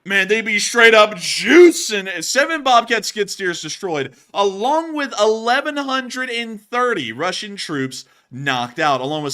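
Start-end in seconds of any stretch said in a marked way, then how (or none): 0.1-5.8 loud, slow emphatic remark; excited. Real, not acted. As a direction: exclaim it slowly and loudly. Reacting to huge numbers.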